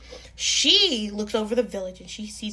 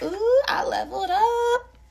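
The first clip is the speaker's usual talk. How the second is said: high, loud, thrilled squeal; a kid bursting with joy at her new rank; excited girl; 13 semitones higher, 7 dB louder than usual. Acted. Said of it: "she's like".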